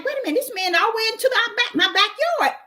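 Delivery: high, fast startled wait a minute; startled disbelief. Retold or imitated. Telling viewers how she reacted, startled and fast. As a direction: catch yourself in disbelief, racing. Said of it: "I'm like".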